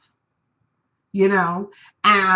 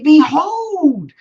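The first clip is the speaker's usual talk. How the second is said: slow, louder mock-grand "Behold"; grand declaration; 4 semitones higher, 8 dB louder than usual. Real, not acted. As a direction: proclaim it. Grand proclamation.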